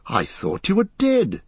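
high astonished cry. A narrator's reading. Aragorn to Frodo he thought slain, a high cry. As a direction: blurt it out in disbelief. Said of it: "he cried".

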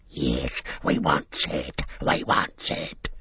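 low, hissing creature voice, slowing into a whisper; Gollum's greedy voice. Acted. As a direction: Gollum craving the ring, dropping to a hoarse whisper.

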